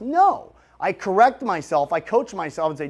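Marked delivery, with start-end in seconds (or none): 0.0-0.5 much higher, louder cutting refusal; firm self-correction. Acted. Coaching myself out of taking it easy, firm self-correction. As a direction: stop yourself with an emphatic no. Correcting own slacking inner voice.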